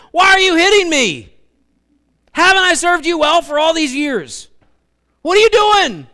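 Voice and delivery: high, loud pleading donkey voice; talking donkey. Acted. Preacher voicing the donkey asking why it is beaten, high loud pleading. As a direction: squeal it out like a hurt donkey.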